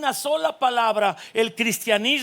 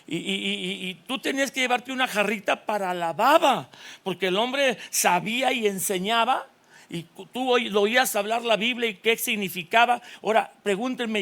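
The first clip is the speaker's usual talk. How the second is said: normal talking voice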